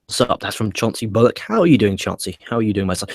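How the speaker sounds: usual speaking voice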